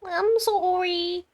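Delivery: high, drawn-out mimicking sorry; mock apology. Player echoing the game character's apology, mocking. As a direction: stretch it out in a mock high whine.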